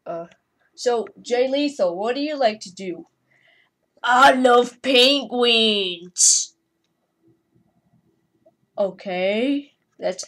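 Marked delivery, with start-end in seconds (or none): 4.0-6.5 very slow, louder silly voice; slow goofy character line; put-on figure voice. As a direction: drawl it like a goofy toy figure.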